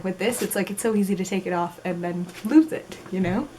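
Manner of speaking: ordinary talk, nothing marked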